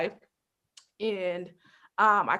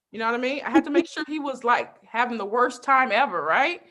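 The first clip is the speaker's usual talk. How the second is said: ordinary delivery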